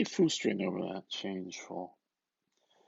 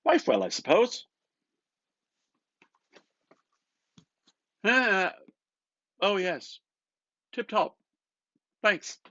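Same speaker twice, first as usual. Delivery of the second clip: brisk, bright small talk; McCoy asking Bloom about his wife, brisk; loud higher chatty exchange; 11 semitones higher, 10 dB louder than usual. Acted. McCoy asks, Bloom answers.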